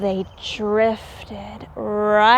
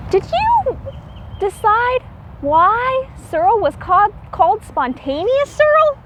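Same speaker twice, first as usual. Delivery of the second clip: teacher question; 8 semitones higher, faster than usual. Real, not acted. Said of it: "asks the children a question".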